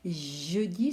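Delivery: "Jeudi" with a long "Jjj" at the start; "Jeudi", first consonant drawn out; exaggerated first sound. Shown as a demonstration. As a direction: hold the opening J of "Jeudi". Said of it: "on entend le je".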